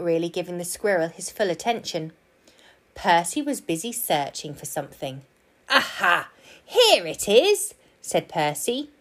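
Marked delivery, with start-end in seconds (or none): 5.7-7.7 high, drawn-out triumphant find; pleased discovery. A narrator's reading. Park keeper finding what he searched for, triumphant. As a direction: announce the find, stretched and high. Percy finds what he sought.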